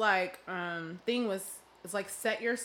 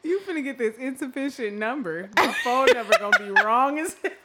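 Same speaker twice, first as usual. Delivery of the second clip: loud, laughing through the words; cracking up while talking; 7 semitones higher, 12 dB louder than usual. Real, not acted. Laughing at broke date.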